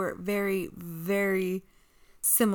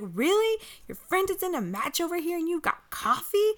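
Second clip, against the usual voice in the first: annoyed Peyton; 9 semitones higher, 5 dB louder than usual. Retold or imitated. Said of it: "she's like".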